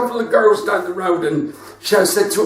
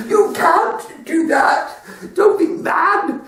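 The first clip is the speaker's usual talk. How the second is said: mock incredulous; 11 semitones higher, slower than usual. Voicing Justin's reply.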